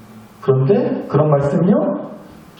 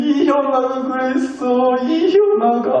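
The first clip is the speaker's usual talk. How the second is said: put-on higher, slow timid child voice; scared little boy; 8 semitones higher, slower than usual. Youngest son telling mom who hit him, timid. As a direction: hesitantly point at big brother. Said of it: "무서워서 차마 말은 못하고".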